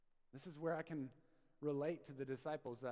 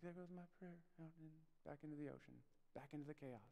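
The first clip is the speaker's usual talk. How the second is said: much softer, deflated aside; quiet resignation; 11 dB quieter, faster than usual. Acted. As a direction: watch it drift off, hushed and resigned. Voices resigned believer losing a prayer.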